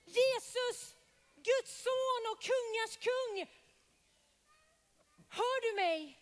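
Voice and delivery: loud, high desperate cry; shouting. Acted. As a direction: cry out with all your might to be heard. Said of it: "Och så ropar han".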